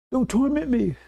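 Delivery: high, acted-out protest; mock tormented plea. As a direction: imitate someone pleading under torment. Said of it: "he's being tormented".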